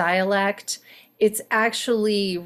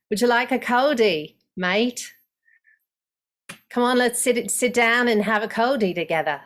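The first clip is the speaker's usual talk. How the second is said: light Aussie voice, a touch raised; Australian accent; 3 semitones higher than usual. Shown as a demonstration. Coach demos Australian slang, light Aussie accent. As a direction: offer a mate a beer in a lightly voiced Aussie way. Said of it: "Australian coldie".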